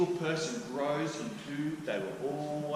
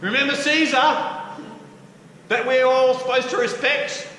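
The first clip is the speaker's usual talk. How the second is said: much higher, loud, mocking sing-song; sarcastic wheedling; 11 semitones higher, 9 dB louder than usual. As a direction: feign loyalty to Caesar with a sneer. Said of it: "So they're going".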